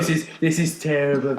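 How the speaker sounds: mock British listener; bad British accent. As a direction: put on a terrible British accent. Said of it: "These people have a terrible British accent".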